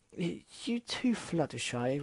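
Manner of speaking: high, stammering disbelief; Rarity stunned Fluttershy agrees, shocked. Acted. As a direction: stumble over it in shock.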